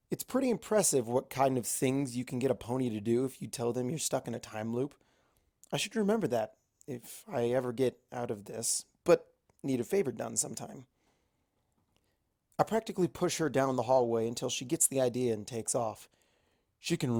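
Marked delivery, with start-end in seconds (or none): none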